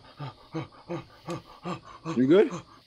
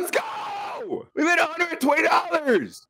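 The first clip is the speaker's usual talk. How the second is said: excited shout; 13 semitones higher, much slower than usual. Real, not acted. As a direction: cheer like you just won. Celebrating earning money in game.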